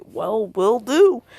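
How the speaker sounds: loud retort. Acted. Sound Four retreat answer.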